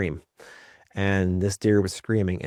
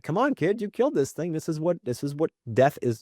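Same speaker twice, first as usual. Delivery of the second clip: 1 urging dad; 7 semitones higher than usual. Retold or imitated. Father pushing queasy son.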